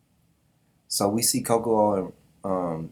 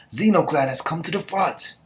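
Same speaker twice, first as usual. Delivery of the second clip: commander's call; 6 semitones higher, 4 dB louder than usual. Acted. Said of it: "this is Moran".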